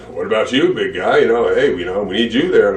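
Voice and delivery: Vince impression. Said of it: "Vince impersonation".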